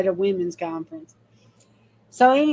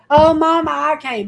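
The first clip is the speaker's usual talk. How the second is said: pleading son; 6 semitones higher, 6 dB louder than usual. Retold or imitated. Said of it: "he starts crying".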